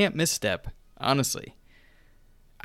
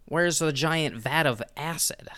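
higher, slow, drawn-out complaint; mock whining fans; 5 semitones higher, slower than usual. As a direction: imitate fans demanding the familiar, stretching each question. Mimics complaining fans of Gotham.

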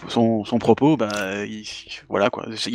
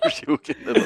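laughing; 7 semitones higher than usual. Real, not acted. Laughing at the joke.

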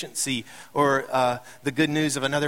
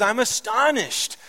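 higher, mildly indignant disbelief; mad-sounding astonishment; 9 semitones higher, slower than usual. Acted. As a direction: quote it with a touch of exasperation. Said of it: "Oh, he's mad".

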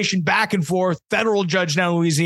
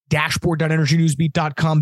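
ordinary talk

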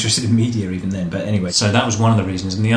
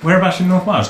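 excited question; 10 semitones higher, 8 dB louder than usual. Real, not acted. Guest asks the host about North Wales, excited and eager. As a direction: pitch jumps up, eager and louder.

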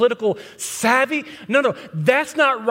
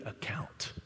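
whispered; 21 dB quieter than usual. Real, not acted.

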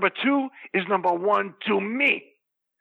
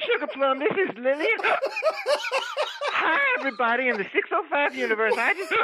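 put-on giggly old lady voice pitched much higher, breaking into laughs; Lillian voice; 10 semitones higher than usual. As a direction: play the tipsy lady guest, cracking up mid-greeting. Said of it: "I'd like to hear a little bit of that character".

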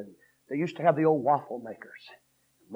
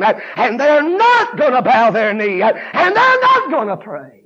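loud, raised sermon building to a high shout; fired-up preaching; 8 semitones higher, 13 dB louder than usual. Real, not acted. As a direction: preach harder and higher toward the last line. Preaches at full force.

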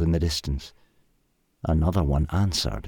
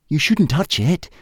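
higher, quick caveman warning; hurried warning; 9 semitones higher, 3 dB louder than usual. A narrator's reading. Og warning Hal off the fire, hurried. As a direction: rush to stop him. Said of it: "said Og hurriedly".

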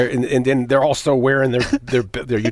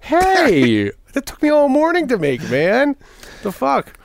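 indignant cook; 12 semitones higher, slower than usual. Pretend. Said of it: "I'd be like".

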